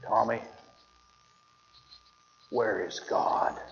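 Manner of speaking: hushed, slow stern question; quiet, drawn-out stern preacher question. Retold or imitated. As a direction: lean in and ask it low and slow, like a stern preacher. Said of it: "as sternly as he could".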